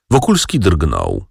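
usual speaking voice

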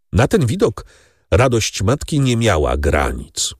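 plain reading voice

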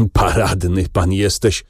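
usual speaking voice

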